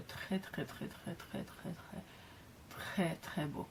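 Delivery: very soft, near-whispered string of très; hushed gushing. Real, not acted. Vlogger gushing to viewers over a nail polish, hushed. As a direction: let the words drop to a slow, breathy hush.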